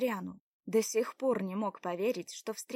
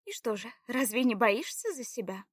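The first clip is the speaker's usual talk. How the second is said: higher-pitched wary questioning; suspicious; 4 semitones higher than usual. A narrator's reading. Заряна questions his reason for helping, suspicious. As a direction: probe his motive warily. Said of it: "подозрительно спросила Заряна".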